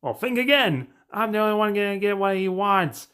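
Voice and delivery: high-pitched taunting villain voice; gloating villain quote. Acted. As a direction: raise the pitch sharply and crow it. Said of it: "He's all like".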